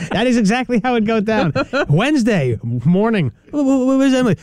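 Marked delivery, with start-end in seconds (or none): 3.5-4.4 higher, stammering befuddled dad; muddled dad asking where his daughter is. Pretend. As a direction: play a dazed, muddled father. Voicing confused [name].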